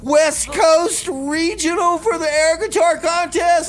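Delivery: high, loud mock outrage; outraged shout. Host to Jeb over lost air guitar, outraged shout. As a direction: wail it like a great loss.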